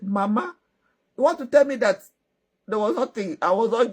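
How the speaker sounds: shocked daughter, pitch jumping up; incredulous. Acted. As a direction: confront mama in acted astonishment. Lady reacts to mama's revelation.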